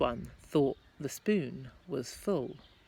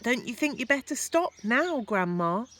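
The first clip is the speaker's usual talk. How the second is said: called out; 8 semitones higher, 6 dB louder than usual. Acted. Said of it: "George called out".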